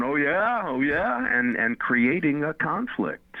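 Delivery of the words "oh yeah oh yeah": high, mocking defensive "oh yeah"; a touch of a hammed-up hothead bristling; defensive comeback. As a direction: throw it back like you're ready for a fight. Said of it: "Rather than going".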